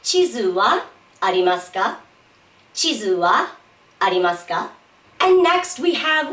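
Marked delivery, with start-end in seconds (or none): 0.0-2.0 "wa" lifted with a rise, small pause after it; stress on wa. Shown as a demonstration. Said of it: "best way to pronounce ... by stressing wa". 2.7-4.7 "wa" lifted with a rise, small pause after it; stress on wa. Shown as a demonstration. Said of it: "best way to pronounce ... by stressing wa".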